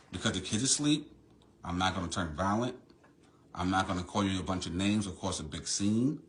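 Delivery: quiet, held-back anger; forced calm. Real, not acted. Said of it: "So I'm trying to stay calm".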